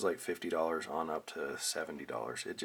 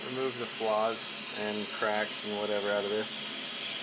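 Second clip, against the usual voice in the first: raised, higher voice over a droning vacuum; talking up over the machine noise; 4 semitones higher than usual. Real, not acted. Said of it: "you'll have to excuse the vacuum noise".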